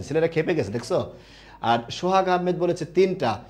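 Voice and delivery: normal talking voice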